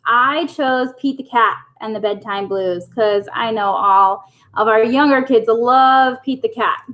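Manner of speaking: ordinary delivery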